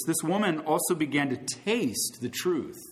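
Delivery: ordinary talk, nothing marked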